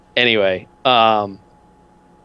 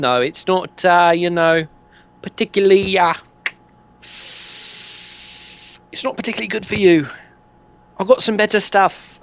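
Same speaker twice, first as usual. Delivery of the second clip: higher, chatty geezer pitch; dodgy dealer; 6 semitones higher than usual. Acted. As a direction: sell it like a dodgy mate. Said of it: "He goes".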